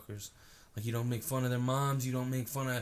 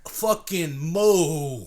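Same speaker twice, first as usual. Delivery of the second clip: slow mock moo; 4 semitones higher, 7 dB louder, much slower than usual. Cow-calling bit.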